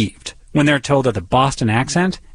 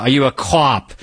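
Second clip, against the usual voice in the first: put-on overdone Boston accent. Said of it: "when they hit that too hard".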